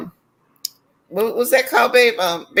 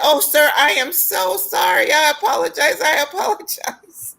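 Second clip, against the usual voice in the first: apologetic clerk; 6 semitones higher than usual. Retold or imitated. Clerk apologizing to the angry boss, in a high, gushing mock apology. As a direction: play the flustered clerk backing down. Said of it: "she said".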